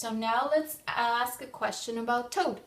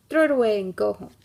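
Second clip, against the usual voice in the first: taunting jeer; 10 dB louder, faster than usual. Acted. Robins taunt Toad.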